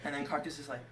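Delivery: usual speaking voice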